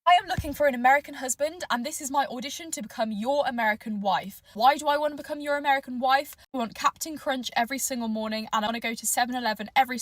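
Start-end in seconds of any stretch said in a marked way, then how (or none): none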